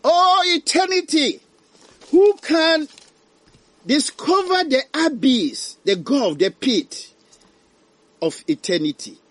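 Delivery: high, loud anguished wail of a dying man; dying man's lament. Acted. Dying sinner wails about eternity, high and anguished. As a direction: cry out in dread of eternity. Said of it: "Ɔse".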